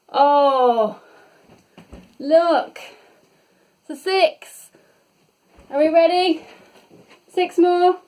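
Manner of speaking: high, loud delighted shout at the roll; thrilled by the six, calling it out; excited. Real, not acted. Fitness teacher cheering the class over rolling a six, excited.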